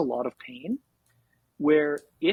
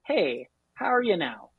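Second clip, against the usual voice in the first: charming Canadian cold-call greeting; Canadian accent. Shown as a demonstration. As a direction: lay on a friendly Canadian accent. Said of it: "using that Canadian accent hard".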